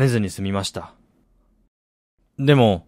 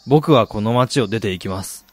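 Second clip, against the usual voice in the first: ordinary delivery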